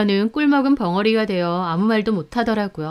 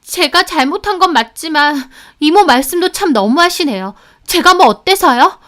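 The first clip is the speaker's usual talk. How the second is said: higher, louder defiant talk back; defiant retort; 8 semitones higher, 7 dB louder than usual. A narrator's reading. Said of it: "송혜연도 노려보더라고요".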